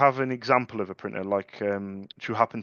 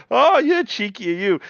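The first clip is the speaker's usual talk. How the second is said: much higher, louder, slow teasing voice; hammed-up high teasing woman; mock cheeky caller; 11 semitones higher, 7 dB louder than usual. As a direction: play her teasing him. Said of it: "She went".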